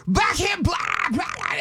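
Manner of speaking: drawn-out mock metal scream; rock screamer. Fan recalling comic twenty years ago, screaming rock style. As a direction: belt it like a wild rock frontman. Imitating his younger loud act.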